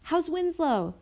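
high-pitched call out the window. A narrator's reading. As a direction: call across to the neighbour. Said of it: "Mac called out the window".

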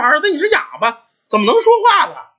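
high, baffled mother's question; puzzled astonishment. A narrator's reading. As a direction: ask in amazement, pitch jumping right up. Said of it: "这老太太纳闷说". (又惊又纳闷，高声发问)

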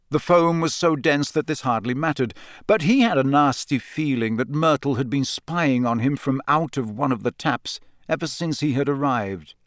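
usual speaking voice

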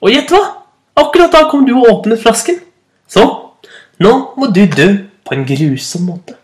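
high, gleeful genie gloating over his victim; a hint of a cackling spirit promising a cruel death; menacing genie. Genie threatens the fisherman. (truende ånd)

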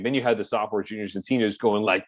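normal talking voice

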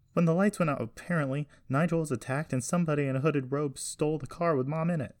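high, urgent account; agitated report. A narrator's reading. Jessie telling grandfather of the stolen car, agitated. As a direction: pour out the bad news, worked up.

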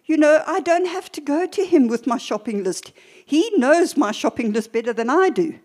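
usual speaking voice

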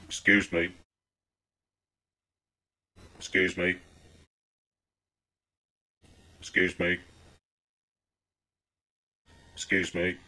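soft, low mumbled excuse me over and over; timid repeated excuse me. Retold or imitated. Man at a car window quietly calling to an oblivious old woman. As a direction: mutter it meekly, again and again. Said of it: "This went on for about two minutes straight".

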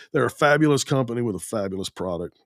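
ordinary talk, nothing marked

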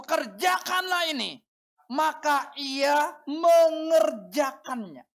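loud, high, slow and stately reading; declaimed scripture reading. Real, not acted. Preacher leading congregation in reading the officer's words, loud declamation. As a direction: proclaim the verse for all to join in.

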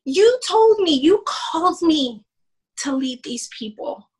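high, loud anguished cry to the Lord; mock scream at God. Pastor's wife reliving screaming at the Lord, high and loud. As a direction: cry out to God as if bleeding inside. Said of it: "screaming at the Lord".

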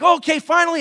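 acted out worn-out arrival; exasperated relief. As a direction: blurt it out, at last. Acting out arrival at beach.

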